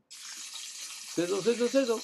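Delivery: whispered hiss then voiced sizzles; hissing sizzle effect; sizzle sound. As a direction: make the pitch sizzle. Hyping a fast pitch.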